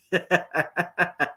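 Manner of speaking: short, chuckling burst of laughter. Real, not acted. Commentator reacting to the mistress jab, laughing.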